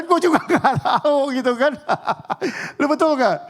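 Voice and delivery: amused, laughing shrug; laughing protest. Real, not acted. Joking self-defence, laughing.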